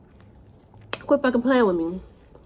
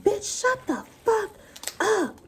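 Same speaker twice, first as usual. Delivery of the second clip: retold yell; 10 semitones higher, 5 dB quieter, much slower than usual. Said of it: "I was like".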